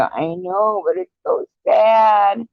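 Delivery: high, slow tearful whimper, hammed up; mock crying whine. At the ice show, answering him in a hammed-up sob voice. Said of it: "I look up and I go".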